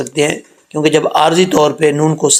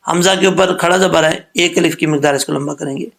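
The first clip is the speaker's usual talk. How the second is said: ordinary talk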